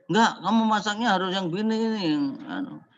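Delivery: mild mock bossiness; bossy correction. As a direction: insist the food be done your way. Bossy Padang cook voiced.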